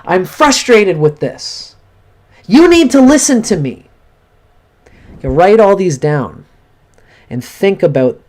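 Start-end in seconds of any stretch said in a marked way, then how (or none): 0.0-3.9 angry yelling. Shown as a demonstration. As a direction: pour out the frustration, demanding to be heard. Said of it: "And you punch the pillow".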